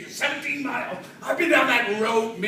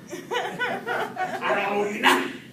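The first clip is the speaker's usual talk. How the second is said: slow, higher, laughing defiance; mock defiance; 7 semitones higher, much slower than usual. Telling the collectors off, slow and laughing. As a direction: drag it out, amused and stubborn.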